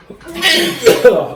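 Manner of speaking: high laughing cough from the heat; spice cough. Real, not acted. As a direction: cough through the burn.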